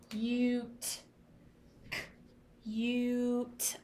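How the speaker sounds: cute with a clear yoo sound, "kyoot". Shown as a demonstration. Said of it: "I hear that U sound".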